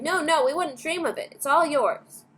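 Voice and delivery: family refusing Charlie's chocolate, insistent; crying out. A narrator's reading. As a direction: wave the offer away. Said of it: "the others all cried".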